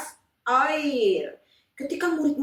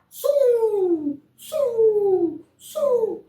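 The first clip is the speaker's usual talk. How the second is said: high, loud wind whoosh; whooshing wind sound; 13 semitones higher, 5 dB louder, much slower than usual. Acted. Storyteller making the wind blow, loud whoosh. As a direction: blow like a gust.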